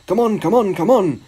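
high, rhythmic repeated urging; puffed urging. A narrator's reading. Gordon puffing to the coaches, eager. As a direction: chug the words out eagerly. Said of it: "he puffed to the coaches".

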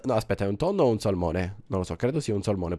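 ordinary talk, nothing marked

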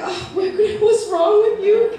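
high, laughing "what's wrong with you?"; mock bewildered. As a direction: play the puzzled bystander, amused. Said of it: "And you're like".

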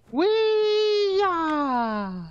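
high wild battle cry; excited yell. Real, not acted. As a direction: whoop it out loud and high. Leaping into fight.

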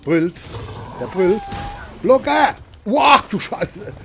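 loud, high panicked yells at the game; panicked shouting. Real, not acted. Gamer yelling at the roaring monster, panicked. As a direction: shout in alarm as the monster roars.

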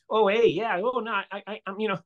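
high, breezy mock Canadian accent with a chirpy lilt. Actor mocking the critics' idea of a Canadian accent, hammed-up cheerful Canadian. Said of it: "he would not sound like".

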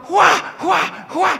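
high, loud mocking cackle; a rooftop rival answering with a high mocking laugh; gloating laugh. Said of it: "y el otro".

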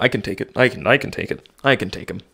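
ordinary talk, nothing marked

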